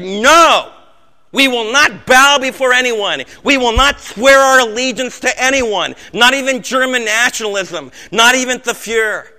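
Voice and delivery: loud, high, defiant declaration; defiant refusal. Retold or imitated. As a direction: proclaim the refusal as a resolute young man. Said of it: "young men that would have said".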